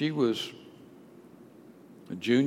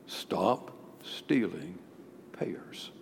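slow, much softer, fading to a whisper; hushed voice of God; 5 semitones lower, 9 dB quieter, much slower than usual. Acted. God quietly telling Augustine to stop, hushed whisper. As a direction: speak it as a quiet inner voice.

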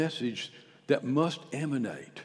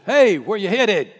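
neighbor's call; 8 semitones higher, 18 dB louder than usual. Acted. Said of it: "Your neighbor says".

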